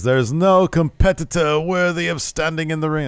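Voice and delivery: deliberately terrible German accent imitating the wrestler; bad German accent. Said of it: "That's a terrible accent".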